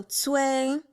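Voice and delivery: Cui held high and level; Mandarin first tone. Shown as a demonstration. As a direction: stretch Cui on an even high tone. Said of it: "That's gonna be the first tone".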